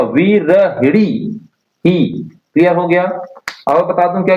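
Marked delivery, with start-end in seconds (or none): 0.0-1.4 विरहिणी stretched very slowly, each syllable clear; drawn-out correct form. Shown as a demonstration. Teacher drilling students on the correct word, very slow. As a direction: spell out विरहिणी slowly for the class. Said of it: "तो अच्छे से पढ़िएगा".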